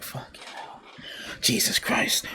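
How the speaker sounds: hushed, voiceless disbelief; near-whispered reaction of dismay; stunned muttering. Retold or imitated. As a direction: curse under your breath, stunned. Acting own stunned reaction to TV.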